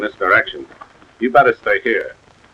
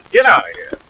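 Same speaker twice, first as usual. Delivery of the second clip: angry; 9 semitones higher than usual. Acted. Driving man away.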